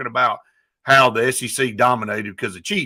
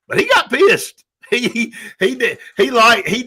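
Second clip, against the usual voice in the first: amused insistence; 10 semitones higher, 3 dB louder than usual. Real, not acted. Laughing insistence on Saban's anger.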